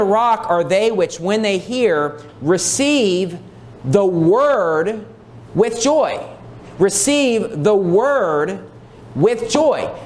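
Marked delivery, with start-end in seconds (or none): 2.4-9.9 "the word" set apart by pauses and drawn out; slow, emphatic reading, landing on "word"; stress on "word". Shown as a demonstration. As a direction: pause before "the word", then stretch it. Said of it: "Notice how the emphasis is on the word of God".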